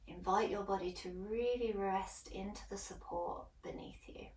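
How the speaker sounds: soft, calm yoga guide's voice; hushed relaxation guidance, letting phrases trail into near-whisper; guided meditation. Acted. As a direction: settle them gently into the breath, softly, with long pauses. Leads breathing and settling in.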